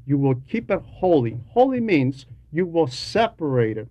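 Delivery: ordinary talk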